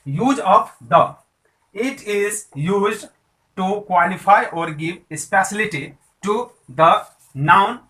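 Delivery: very slow, word by word dictation; dictation pace. Shown as a demonstration. Teacher dictating the rule of the to students. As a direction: read the rule out slowly so students can copy. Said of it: "dictating the rule slowly".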